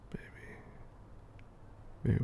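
normal talking voice

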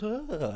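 high, mock swooning "ah"; fake fainting sigh. Malfoy's friend mimicking a faint, high mock swoon. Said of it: "does, like, a fake fainting thing".

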